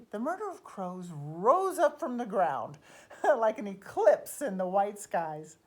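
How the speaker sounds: loud, sweeping storytelling surge; dramatic swell. A narrator's reading. Telling how the murder of crows rose up, loud and dramatic. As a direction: let the voice soar with the rising flock. Narrates the crows suddenly rising.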